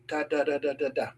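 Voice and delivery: soft, rhythmic tapping syllables; light, even string of quiet taps; imitated tapping. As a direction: mimic a little tap on wood, quietly. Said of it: "there's a little tap".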